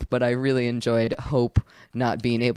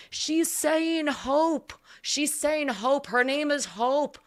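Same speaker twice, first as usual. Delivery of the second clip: mock crowd yelling; 13 semitones higher, slower than usual. Back rows relaying her name to a deaf man, mock yelling. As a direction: imitate people shouting from the back. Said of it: "people in the back are yelling".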